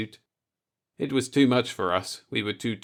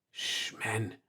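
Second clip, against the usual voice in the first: much softer hush; 10 dB quieter than usual. Acted. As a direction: shush the men under your breath. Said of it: "Nagurski said".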